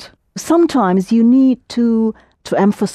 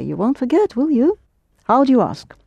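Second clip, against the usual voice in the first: usual speaking voice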